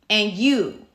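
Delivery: loud, pointed jab as the neighbor; single the kid out, mock hostile; imitated cussing out. Neighbor looks a kid up and down, mock confrontational. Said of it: "cussing me out".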